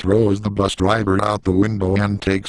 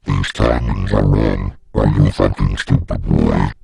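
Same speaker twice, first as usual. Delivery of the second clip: much lower and louder, a booming monster voice; monstrous dragon lady; 12 semitones lower, 3 dB louder than usual. Acted. The Dragon Lady teacher berates a student in a deep, booming monster voice. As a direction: growl it down low like a beast scolding a pupil. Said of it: "she is all like".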